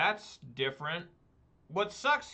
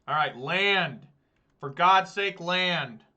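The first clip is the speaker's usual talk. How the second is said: louder, exasperated plea to the deck; pleading for a land; 3 semitones higher, 7 dB louder, slower than usual. Real, not acted. Player urging his own draw, louder and exasperated. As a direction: beg the top card, fed up.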